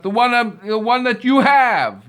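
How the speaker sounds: loud, high accusing shout; furious accusation. Acted. Owner yelling that the sleeper has his belt. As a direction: jab every word at him.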